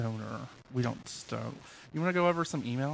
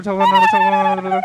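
excited squeal; 14 dB louder, faster than usual. Real, not acted. Mocking recorded number voice.

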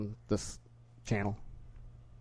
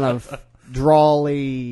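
slow drawl; 7 dB louder, much slower than usual. Shown as a demonstration. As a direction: stretch DRAWLY way out, slowly. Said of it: "you gotta talk real slow".

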